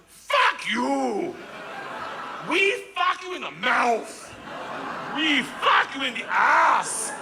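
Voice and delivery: high, slow, drawn-out yell; hammed-up henchman shouting abuse; acted shouted threat. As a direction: bellow each insult as a gangster, stretching the words. Acts out Igor shouting at cop.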